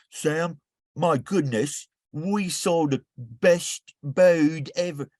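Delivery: high-pitched, hammed-up English accent, drawn out slowly; amateur English accent, slow and high. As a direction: try on a posh English visitor's accent, a bit over the top. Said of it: "Okay, I'll try".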